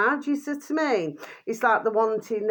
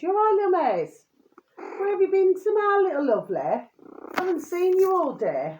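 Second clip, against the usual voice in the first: high cooing pet talk, much higher; talking to the dog; 10 semitones higher than usual. Real, not acted. Greeting her little dog Maze.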